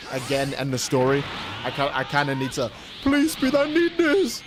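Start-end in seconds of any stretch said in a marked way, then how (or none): 3.0-4.4 high, mock-begging voice; mock plea. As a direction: beg like a desperate fan, hammed-up. Mock pleading like chat.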